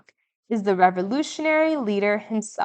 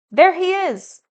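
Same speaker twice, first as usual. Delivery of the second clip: excited cry; 8 semitones higher, 5 dB louder than usual. A narrator's reading. Mother spots father in parade.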